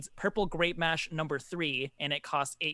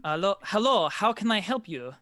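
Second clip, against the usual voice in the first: polite, formal innkeeper greeting, a little higher and louder; 4 semitones higher, 5 dB louder than usual. Acted. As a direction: welcome a guest with stiff courtesy. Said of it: "I'll start out formal".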